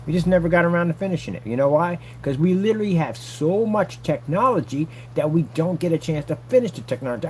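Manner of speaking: ordinary talk, nothing marked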